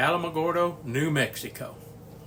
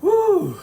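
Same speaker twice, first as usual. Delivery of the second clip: winded whoop; 8 dB louder than usual. Retold or imitated. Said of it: "huffing and puffing and sweating".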